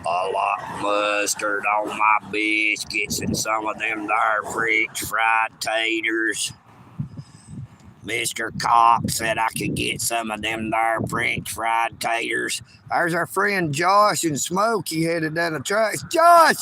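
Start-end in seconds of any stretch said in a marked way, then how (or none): 0.1-12.6 very low, monotone backwoods character voice; deep, level, countrified movie-character impersonation; put-on character voice. Shown as a demonstration. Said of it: "I do like to talk like this sometimes as well". 16.0-16.5 loud, high shout of a name; real call-out, pitched up and loud. Real, not acted. Calling out to friend on tracks.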